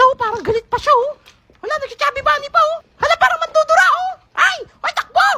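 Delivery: high frantic shrieking run; high frightened children; panicked kids. Acted. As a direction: scream and flee like scared kids.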